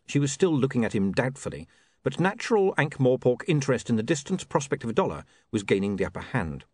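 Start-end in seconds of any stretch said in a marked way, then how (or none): none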